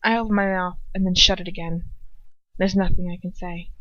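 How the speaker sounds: ordinary delivery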